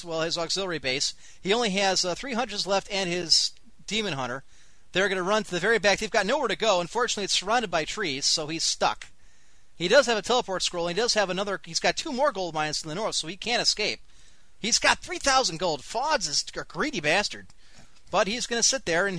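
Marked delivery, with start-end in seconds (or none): none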